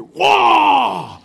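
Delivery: sudden high loud whoa; high startled shout of pain; startled yelp. Retold or imitated. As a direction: yelp it out as the bite lands. Grandson bites him, cries out.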